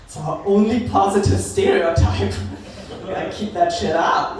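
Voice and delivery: ordinary delivery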